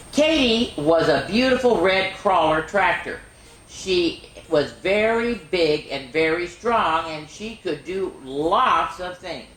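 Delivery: slow, clear picture-book reading; gentle storybook narration; reading to children. Acted. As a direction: read it slowly for little ones. Reads Katie story aloud.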